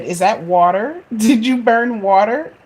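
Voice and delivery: mock her through rising laughter; son laughing. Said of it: "he said".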